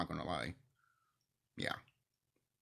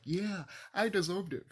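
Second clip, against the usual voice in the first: mock self-satisfaction; 9 semitones higher than usual. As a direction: mimic someone pleased with themselves. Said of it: "and be like".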